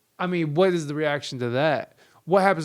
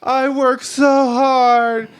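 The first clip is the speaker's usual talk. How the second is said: high, loud, very slow martyred wail; martyr whine; 8 semitones higher, 6 dB louder, much slower than usual. Retold or imitated. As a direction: ham up a self-pitying martyr. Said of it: "sitting there on her cross dying like".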